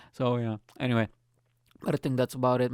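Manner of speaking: ordinary delivery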